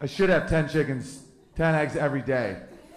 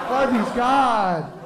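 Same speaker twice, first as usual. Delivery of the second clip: high, slower still, trailing hen; cowed chicken; 10 semitones higher, 6 dB louder, much slower than usual. Retold or imitated. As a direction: stretch "Harvey's God" even further in a high hen voice. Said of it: "Meryl Streep chicken's in the corner like".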